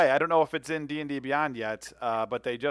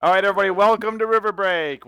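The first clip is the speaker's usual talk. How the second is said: energetic welcome; 6 semitones higher, 7 dB louder than usual. Real, not acted. Host opens the stream.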